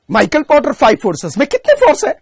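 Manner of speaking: high, loud, quickened mock quizzing; mock exam question. Mocking an absurdly obvious exam question.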